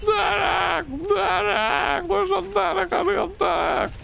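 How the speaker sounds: high, desperate yell for help; screaming for a medic. Retold or imitated. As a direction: shout for the medic at the top of your voice. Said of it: "You just scream at him like".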